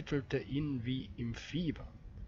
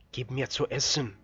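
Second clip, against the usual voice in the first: a hoarse, louder call for food; 4 dB louder than usual. A narrator's reading. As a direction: rasp it out, starving. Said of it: "rief jener mit heiserer Stimme".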